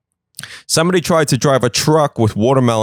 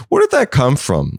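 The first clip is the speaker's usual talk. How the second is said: ordinary talk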